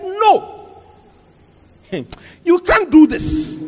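high-pitched, indignant refusal; retold anger. Pastor refusing the Land Cruiser gift, retold anger. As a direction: act out an angry pastor's protest. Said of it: "He got angry".

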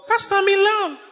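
mock a lovesick young woman; lovestruck plea. Young woman telling her pastor she's in love, high swooning mock.

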